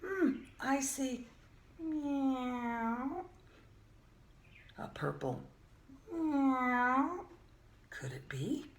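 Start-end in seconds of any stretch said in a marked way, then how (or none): 1.8-3.2 a drawn-out rising meow; cat meow. Acted. Purple cat is guessed next. 6.1-7.2 a drawn-out rising meow; cat meow. Acted. Purple cat is guessed next.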